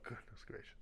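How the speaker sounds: breathless whisper of embarrassment; mock whispered exasperation. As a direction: whisper it to yourself, defeated. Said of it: "I was just like".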